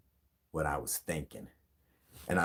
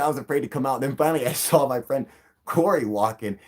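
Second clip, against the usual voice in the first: ordinary delivery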